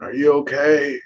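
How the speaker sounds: concerned shout across the wreck; worried call. Acted. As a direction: call out to check they're alive. Acted crash scene, checking on driver.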